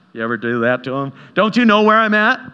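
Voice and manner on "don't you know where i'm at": complaining to God. Retold or imitated.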